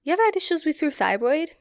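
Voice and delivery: high, fast mock technician voice; quoting the technician. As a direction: toss off the question like a busy tech. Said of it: "And he goes".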